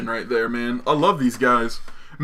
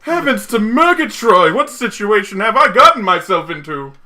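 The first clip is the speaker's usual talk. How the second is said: put-upon fish voice; 5 semitones higher than usual. Retold or imitated. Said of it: "His mouth makes him look like".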